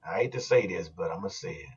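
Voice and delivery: low, hushed confession; quiet, low and grudging; reluctant admission. Real, not acted. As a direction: drop the voice way down, reluctant to say it. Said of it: "why you gotta say it like that".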